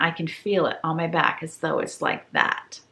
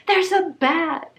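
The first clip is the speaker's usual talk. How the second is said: scared; 11 semitones higher, 4 dB louder, slower than usual. Retold or imitated. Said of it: "And I go".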